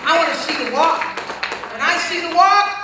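loud, high peak of the sermon; roaring preacher climax; preaching shout. Real, not acted. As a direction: belt it out at the top.

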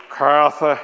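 a light imitation of Leroy's tender address. Imitating his friend toasting his wife, lightly voiced. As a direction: say her name the way Leroy would. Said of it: "I did it like, like Leroy".

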